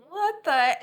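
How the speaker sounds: high, loud mock disbelief; mock bafflement. Painter reacting to her own painting, mock baffled. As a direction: blurt it out in comic dismay.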